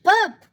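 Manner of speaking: loud mock burp. Reading to a child, a loud mock burp. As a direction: belch it out for the kids.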